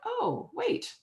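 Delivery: light mock surprise at the discovery; sudden realization. Realizing that boards hire the CEO, surprised. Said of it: "I was like".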